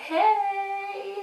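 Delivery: very high, sung-out hello; nervous, excited greeting. Retold or imitated. Candidate greeting the recruiter on the phone, nervously excited. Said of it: "A bit nervous, a bit excited".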